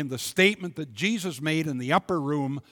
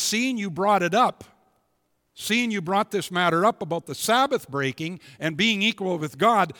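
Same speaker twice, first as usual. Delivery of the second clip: loud, raised preaching voice; full-force preaching; 6 semitones higher, 7 dB louder than usual. Acted. Preacher voicing Jesus to the congregation, loud and raised.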